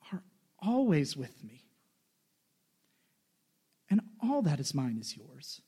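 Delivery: a father quietly reassuring his son; father gently comforting his angry son; tender father. Acted. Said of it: "Then the father said to him".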